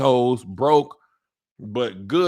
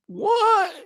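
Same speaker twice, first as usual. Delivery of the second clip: squeaky, much higher startled "What?"; shocked what; 5 dB louder than usual. Retold or imitated. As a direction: jump up into a stunned squeak. Off shocked at bar ban.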